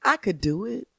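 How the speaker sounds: ordinary talk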